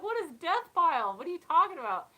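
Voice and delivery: high, loud retold bafflement; hammed-up confused demand; baffled questioning.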